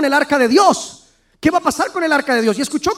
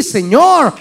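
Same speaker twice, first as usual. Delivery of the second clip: usual speaking voice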